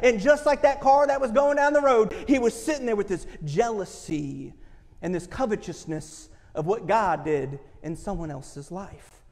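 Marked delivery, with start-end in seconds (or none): none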